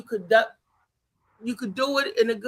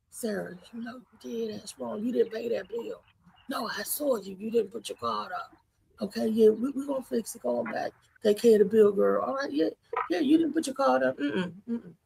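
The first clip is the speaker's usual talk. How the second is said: much softer, discreet word aside to a friend; discreet quiet word; 5 dB quieter than usual. Retold or imitated. Pulling friend aside about bill.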